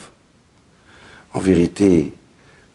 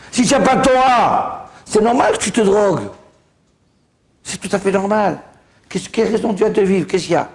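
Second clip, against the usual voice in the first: preaching; 7 semitones higher than usual. Acted. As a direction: preach it out with force. Preaching at full force.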